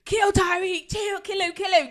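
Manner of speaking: high, loud, insistent chant; imitated mob outcry. Voicing Twitter and Insta's reaction, loud mock outrage. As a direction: cry it out like an outraged crowd.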